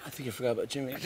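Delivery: soft, hushed reminder; quiet tip-off; whispered aside. Retold or imitated. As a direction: lean in and murmur the reminder. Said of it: "he whispered".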